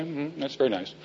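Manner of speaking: unimpressed murmur. Retold or imitated. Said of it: "He'd say".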